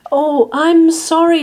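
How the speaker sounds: high, loud, fussing apology; apologetic Martina. A narrator's reading. Martina apologises to the pig, high and fussing.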